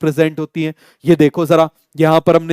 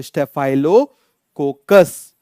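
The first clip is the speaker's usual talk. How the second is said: slow drill; 3 semitones lower, much slower than usual. Shown as a demonstration. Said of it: "तो हम क्या बोलेंगे इसको?".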